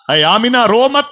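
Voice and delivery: ringing call not to weep. Acted. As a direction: call out to her from above. Divine voice calling to Amina.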